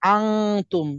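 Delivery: আংতুম with a held ng before tum; আংতুম, n hidden as ng; nasal ikhfa. Shown as a demonstration. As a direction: send the middle of আংতুম through the nose. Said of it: "অনুসার মতো উচ্চারণ করতে হবে".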